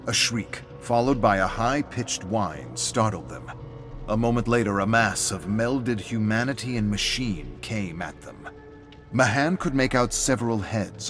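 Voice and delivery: ordinary delivery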